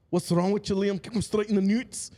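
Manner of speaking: loud, high Scottish brogue; comic high-pitched Scottish voice; Scottish accent. Shown as a demonstration. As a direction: shout it like a hammed-up Scotsman. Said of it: "I'll do my Scottish voice".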